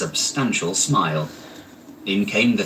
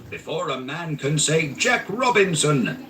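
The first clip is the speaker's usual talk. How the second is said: put-on high, lively old master; jovial Fezziwig; 7 semitones higher than usual. A narrator's reading. Fezziwig hurrying his apprentices, jovial. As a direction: urge them on cheerfully. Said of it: "cried old Fezziwig".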